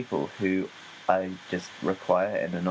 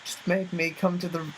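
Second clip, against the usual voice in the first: mock indignant; 6 semitones higher than usual.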